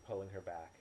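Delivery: plain reading voice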